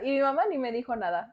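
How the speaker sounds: ordinary talk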